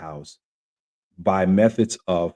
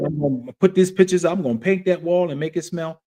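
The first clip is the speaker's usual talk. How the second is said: relieved excitement; 6 semitones higher, 4 dB louder than usual. Retold or imitated. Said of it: "And I was like".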